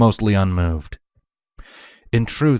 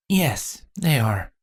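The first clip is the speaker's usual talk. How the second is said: fairly confident; 5 semitones higher, much slower than usual. A narrator's reading. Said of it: "with a measure of confidence".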